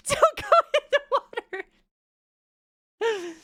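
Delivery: laughing through it, fast and high. Real, not acted. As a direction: giggle out the warning. Repeats rule while laughing.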